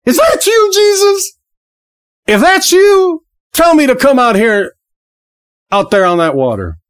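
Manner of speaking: high, eager voice, calling out across the water; brash Peter. Retold or imitated. Preacher acting out brash Peter calling to Jesus on the water, high and eager. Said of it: "Peter, with his big mouth".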